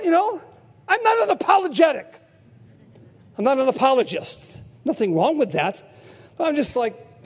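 normal talking voice

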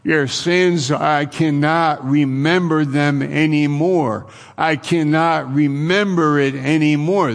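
God's promise called out high and loud; loud proclamation. Acted. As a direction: proclaim it loudly and clearly. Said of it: "I should say it more c-, loudly and more clearly".

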